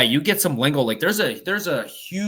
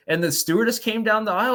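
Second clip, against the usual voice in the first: ordinary delivery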